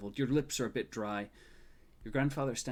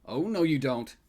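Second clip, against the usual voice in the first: loud, booming and higher; booming grandfather; 4 semitones higher, 9 dB louder than usual. A narrator's reading. Grandfather stopping his grandson's spiral, booming and loud. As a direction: boom it out to stop him. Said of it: "grandfather's booming voice says".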